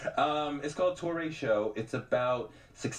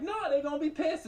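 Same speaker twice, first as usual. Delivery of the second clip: worried angel voice; faster than usual. Retold or imitated. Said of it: "the other one's like".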